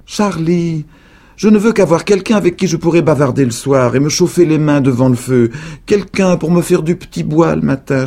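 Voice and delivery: voix aiguë et plus forte de vieille; vieille dame suppliante. A narrator's reading. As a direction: supplier comme une vieille sorcière seule. (la vieille dame implore Charlie, voix haute et forte)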